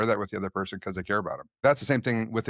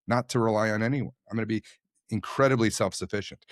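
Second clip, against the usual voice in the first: ordinary talk, nothing marked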